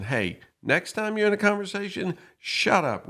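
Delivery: high, pointed mock scolding of someone who shared. Society scolding a person who opened up, mock scolding. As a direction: pitch it up like a scolding crowd. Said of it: "all we did was convince that person".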